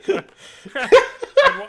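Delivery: loud, high, slowed words swallowed by laughter; laughing. Real, not acted. Host cracks up picturing the singer with a gun. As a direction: try to talk through a laughing fit.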